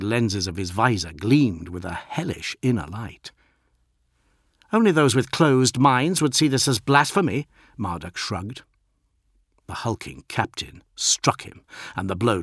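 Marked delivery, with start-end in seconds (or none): 4.7-7.5 higher, louder defiant retort; defiant postulant. A narrator's reading. Postulant answers his enraged master, defiant. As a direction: argue back with raised pitch and volume.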